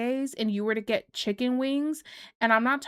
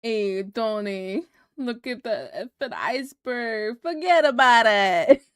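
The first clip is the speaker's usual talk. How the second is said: hammed-up Jersey wiseguy, building to a loud finish; Jersey accent; 4 dB louder than usual. Warning Tony about the iceberg, broad mock Jersey accent. Titanic redone with Jersey characters.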